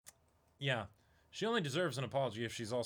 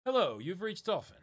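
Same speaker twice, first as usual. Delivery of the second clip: higher polite business caller; polite phone voice; 8 semitones higher, 6 dB louder than usual. Acted. Tour agent calling the dive shop, higher formal phone voice. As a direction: sound formal and bright on the phone.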